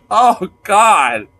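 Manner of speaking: ordinary delivery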